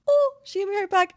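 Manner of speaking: high, loud, fast delight; excited squeal. Real, not acted. Player thrilled her pet friend gave back the heart, squealing. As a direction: gush it out in a rush.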